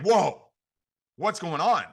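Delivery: a startled whoa, then the question; surprised. Retold or imitated. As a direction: caught off guard by the urgent call. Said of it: "And they're like".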